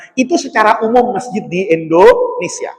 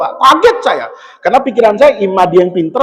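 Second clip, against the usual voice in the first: usual speaking voice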